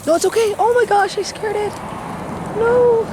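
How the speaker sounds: high soothing pet-talk voice; holding down a pet. Real, not acted. Calling to a startled deer.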